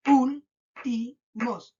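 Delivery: "Últimos" very slowly as ÚL, ti, mos; three separate beats, strongest on ÚL in "Últimos"; syllable by syllable. Shown as a demonstration. Teacher counting syllables, "Últimos" in three slow beats. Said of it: "Tiene tres sílabas".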